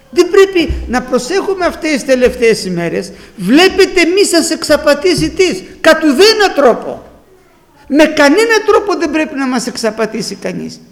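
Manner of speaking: forceful preaching. Acted. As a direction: drive the warning home to the flock.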